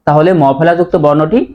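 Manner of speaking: usual speaking voice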